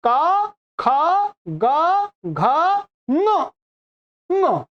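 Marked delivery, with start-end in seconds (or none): none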